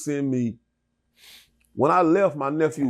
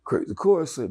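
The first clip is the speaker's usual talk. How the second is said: ordinary delivery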